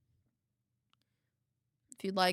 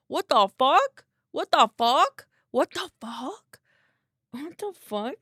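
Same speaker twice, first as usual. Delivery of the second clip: shocked disbelief; 6 semitones higher, 5 dB louder than usual. Real, not acted. Repeated disbelief at follows.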